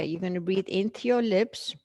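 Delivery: ordinary delivery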